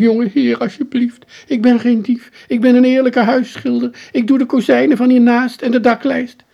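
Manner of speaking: high, loud, outraged working man protesting innocence; indignant painter. Acted. Trapped painter protests to the boy, high and indignant.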